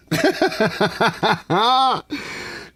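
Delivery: high cackling laugh. Real, not acted. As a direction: laugh at your own joke. Laughs at his own retort.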